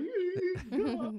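high, cracking yeahs; mock puberty voice; reluctant teen singer. Forced to sing through puberty. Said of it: "So I'd be like".

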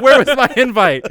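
hammed-up annoyed outburst; mock outrage. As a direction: play the friend left out, offended. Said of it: "Chris is mad".

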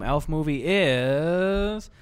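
slow, drawn-out build to a high, loud number; dramatic reveal. Acted. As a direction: announce the score like a game show host. Dramatic reveal of a movie score.

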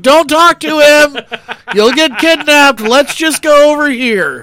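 loud, high, drawn-out warning; urgent retold warning. Husband steering his wife away from a stranger, urgent. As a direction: stretch it out loudly, high-pitched. Retold alarm at wife's exchange.

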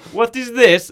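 high, loud naive question; Borat. Acted. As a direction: ask it in a put-on foreign accent. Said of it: "Borat asks what things are".

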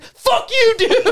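high-pitched, laughing mock outburst; mock angry comeback. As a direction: fire back at an imagined heckler, half joking. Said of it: "I'll be like".

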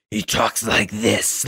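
hint at a menacing villain without pushing it; deep scary villain voice. Retold or imitated. Podcaster imitating Rhaegar's deep scary voice, lightly slowed. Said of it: "who has this voice".

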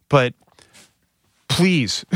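normal talking voice

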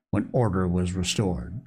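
usual speaking voice

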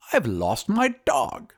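high, slow wizard voice. A narrator's reading. Wizard announces his loss, high slow character voice. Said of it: "he announced".

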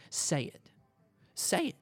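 daring him to say it; a short challenge, left hanging, then repeated; retold dare. Man daring his friend to cuss, retold challenge. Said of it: "Just like that".

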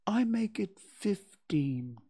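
hesitant, drawn-out answer; ponderous Pooh. Acted. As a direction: work it out slowly, drawing out the number. Pooh answers after long frowning.

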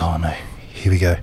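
resigned. Retold or imitated. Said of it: "I was like".

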